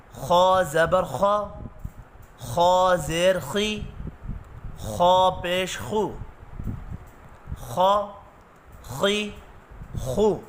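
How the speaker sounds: slow, spelled-out Qaida drill; syllable drill. Shown as a demonstration. Teacher drilling the class through Noorani Qaida syllables. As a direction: spell each letter with its harakat slowly.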